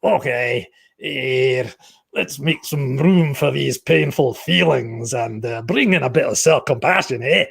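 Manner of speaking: put-on Glaswegian, unhurried and encouraging; Glaswegian accent. Therapist showing self-compassion in a Glaswegian accent. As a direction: gently coax in a mock Glaswegian accent. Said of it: "a Glaswegian accent in these times".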